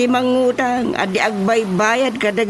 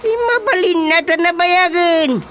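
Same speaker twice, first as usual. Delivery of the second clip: scolding exclamation; 8 semitones higher than usual. Acted. Chairman scolding the debtor.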